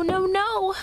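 usual speaking voice

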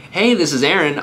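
mirror an upbeat caller's energy; lively matching greeting. Shown as a demonstration. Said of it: "I'll match that".

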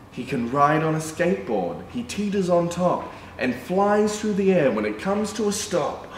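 sing-song picture book reading; reading to children. A narrator's reading. Reading a rhyming dog book to children, sing-song. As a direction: read the rhyme to little kids.

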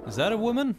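mock high-pitched voice. Repeating a joke line.

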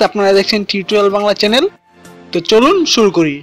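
normal talking voice

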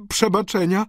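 high, loud begging woman; desperate high female exclamation; cried-out plea. A narrator's reading. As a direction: cry out for forgiveness. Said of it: "zawołała".

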